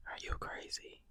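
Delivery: soft incredulous whisper. Real, not acted. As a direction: drop to a whisper, still in disbelief. Same question repeated, dropped.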